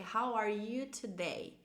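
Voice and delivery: slow, measured English question; slow model phrase. Shown as a demonstration. Teacher gives learners a question to repeat, slowly. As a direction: stretch it out for repeating. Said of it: "pode repetir comigo também".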